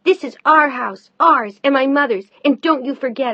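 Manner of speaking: put-on loud, high defiant teen. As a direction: spit it at her, high and loud. Pam defiant to stepmother.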